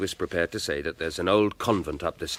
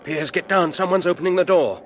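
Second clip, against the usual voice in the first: high, louder alarmed call; urgent loud warning; 10 semitones higher, 9 dB louder than usual. Acted. Alfred warning Piers to get down, urgent and loud. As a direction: warn him fast, someone's coming.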